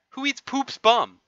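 hammed-up outraged cry; mock outrage. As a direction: squeal it in disbelief. Outraged at being eaten.

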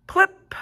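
high loud plip, like a drop; sound effect. A narrator's reading. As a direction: make the raindrop pop out high. Rain sound effect read aloud.

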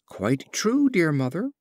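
an eager little crab answering back; young crab. A narrator's reading.

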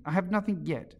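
high, mildly affronted reply; understated huffy Alice; offended. A narrator's reading. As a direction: answer with a touch of offence. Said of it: "in an offended tone".

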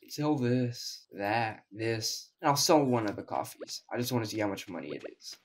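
normal talking voice

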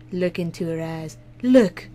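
a hint of an ad voiceover; loosely imitating a commercial; commercial impression. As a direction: lightly mimic a TV ad line. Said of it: "Anyone know that commercial?".

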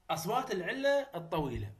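ordinary talk

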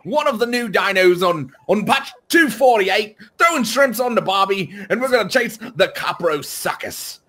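playing an Aussie bloke for the camera; mock Australian accent. Australian accent hammed up to greet the viewers. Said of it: "I can't do this accent anymore".

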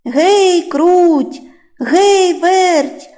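high, loud rooster's holler; long-stretched hey and names; calling out. A narrator's reading. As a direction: call the mice from across the yard. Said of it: "знов гукає".

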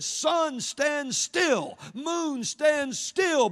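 high, loud shouted command to the sky. Retold or imitated. Preacher voicing Joshua commanding the sun and moon, shouted. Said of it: "Joshua just stopped and said".

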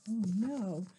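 ordinary delivery, nothing marked

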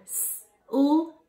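"S-L-I-M" as separate speech sounds; "S-L-I-M" sound by sound, gaps between; word chopped into sounds. Shown as a demonstration. Teacher segmenting slime for kids, "S-L-I-M" chopped up. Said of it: "I'm going to chop up a word".

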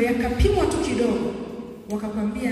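usual speaking voice

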